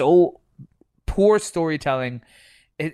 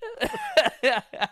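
high shrieking laugh. Real, not acted. Friends joking about wrestling.

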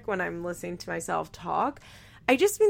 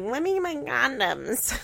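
lightly put-on baby voice, pitched up; hint of a babyish whine on a mock quote; 5 semitones higher than usual. Host mimicking Cole asking for condoms, baby voice. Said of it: "That's not his baby voice".

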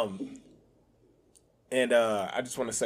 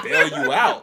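laughing quote; 4 semitones higher, 10 dB louder, slower than usual. Retold or imitated. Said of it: "damn, I said".

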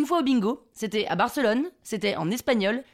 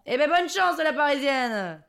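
teasing southern local; 3 semitones higher, slower than usual. Retold or imitated. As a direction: send her off with southern mockery. (bonne chance moqueuse à la marseillaise)

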